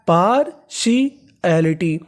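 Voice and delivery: Partiality very slow, broken into parts; drawn-out teaching pronunciation of partiality; syllable by syllable. Shown as a demonstration. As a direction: sound out partiality piece by piece for learners. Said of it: "तो पूरी meaning क्या बनी".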